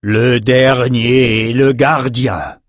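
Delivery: huge, very slow booming dragon; booming frost giant. A narrator's reading. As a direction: boom it out like an ancient dragon. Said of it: "tonna le reptile géant".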